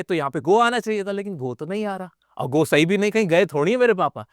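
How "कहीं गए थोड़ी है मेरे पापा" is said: ordinary talk, nothing marked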